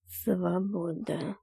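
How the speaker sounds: slow, soft and pensive; thoughtful. A narrator's reading. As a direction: repeat it slowly, lost in thought. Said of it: "задумчиво повторил".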